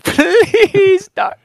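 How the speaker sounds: whining very high plea to the Irish doctor; patient begging the doctor in a high whine. Pretend. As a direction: squeak out the please like a desperate patient. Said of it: "I'm begging you".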